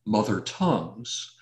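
ordinary delivery

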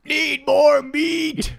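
very slow, high, loud meathead; caveman bro. Retold or imitated. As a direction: drag it out like a caveman lifter. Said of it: "Everything was misspelled".

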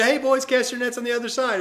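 high, loud call to the boat. Retold or imitated. Jesus calling to the fishermen, high loud call. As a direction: call it across the water. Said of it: "He said".

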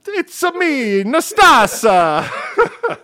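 very high, loud cartoon Italian; hammed-up Italian squeal, last word stretched out; mock Italian accent. Host playing the birth parent on the phone, mock Italian accent.

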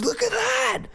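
high squeal of alarm; frantic pointing. Retold or imitated. Manager pointing at the TV news, high shriek. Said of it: "And Curtis goes".

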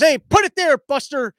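a cheery put-it-there shout to nobody; imitating Biden. Biden hails an empty stage for a handshake, imitated loud and high. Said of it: "he turns to the side and goes".

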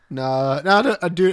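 ordinary talk, nothing marked

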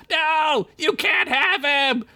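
very high, drawn-out scream of protest; defiant yell. A narrator's reading. Chickpea raising her staff at the eye, defiant yell. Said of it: "she yelled".

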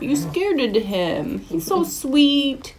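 drawn-out pet-doting sympathy; cooing reproach. Real, not acted. Owner fusses over the startled dog, slow high cooing.

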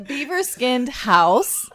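laughing. Real, not acted. Laughing during story.

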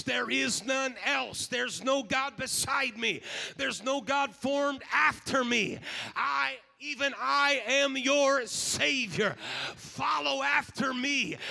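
slow, weighty proclamation; preaching. Acted. Preacher proclaiming God's words to the congregation, slow and weighty. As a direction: declare each word slowly.

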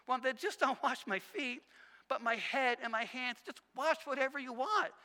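eager, loud and high outburst; over-the-top Peter. Acted. Peter suddenly offering Jesus head and hands, over the top. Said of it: "over the top with everything".

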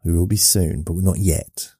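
ordinary talk

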